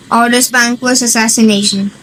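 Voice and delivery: normal talking voice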